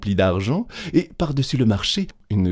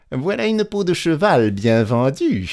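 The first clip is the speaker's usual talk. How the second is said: high-pitched, pleased crow; gleeful exclamation; 7 semitones higher, 3 dB louder than usual. A narrator's reading. Petit Claus back home with his money, triumphant exclamation. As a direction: exclaim over a great deal, voice lifted. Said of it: "s'écria-t-il".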